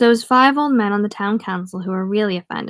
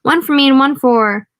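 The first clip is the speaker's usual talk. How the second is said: yelling at bartender; 5 semitones higher, 6 dB louder than usual. Acted. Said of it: "He yells at the bartender".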